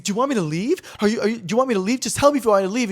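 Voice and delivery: imitating a rattled man; retold anxious asking. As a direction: press her for an answer, mildly desperate.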